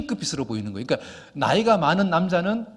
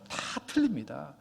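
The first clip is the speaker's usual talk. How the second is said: ordinary talk, nothing marked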